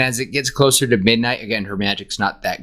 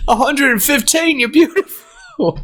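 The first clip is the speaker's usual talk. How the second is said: quoting video; 14 semitones higher, 3 dB louder than usual. Retold or imitated. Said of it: "Have you seen that video".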